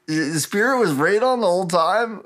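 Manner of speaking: high, swooping astonishment, played up; mock stunned disbelief. Serena realizes Spirit was Raedon, mock stunned disbelief. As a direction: stammer into a high-pitched "what?".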